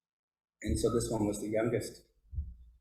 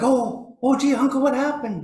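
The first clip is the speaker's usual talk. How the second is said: high, loud startled concern; retold alarm; 13 semitones higher, 6 dB louder than usual. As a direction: act out sudden worry for a crying uncle. Retold alarm at uncle crying.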